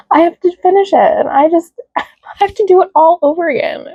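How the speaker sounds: high, tearful complaint; about to cry. Real, not acted. Has to redo the video all over again, tearful. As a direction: whine it high, real tears threatening. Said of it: "I'm, like, about to cry".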